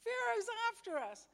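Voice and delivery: hammed-up panic, voice shooting way up; panicked freak-out. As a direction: act out a terrified Israelite crying the alarm. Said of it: "looks back and he freaks out".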